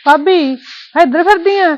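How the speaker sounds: loud high call. Acted. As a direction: shout her name, then ask. Calling out to sister-in-law.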